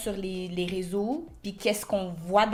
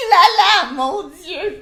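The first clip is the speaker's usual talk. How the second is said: excited; 9 semitones higher, 8 dB louder, slower than usual. Real, not acted. Unboxing the gift, excited.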